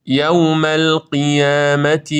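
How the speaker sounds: slow chanted یوم القیامت; drawn-out "yawmal-qiyaamati"; model recitation. Shown as a demonstration. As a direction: join یوم القیامت with a still, unbounced ل. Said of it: "در وصل به ما قبل نباید تکون بخوره".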